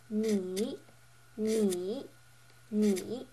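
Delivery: "ni" dipping then rising; third tone. Shown as a demonstration. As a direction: draw a check mark with the pitch on "ni". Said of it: "The third tone is the check mark".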